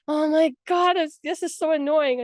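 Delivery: high, exasperated mock complaint. Friend messaging her about annoying companions, mock exasperated.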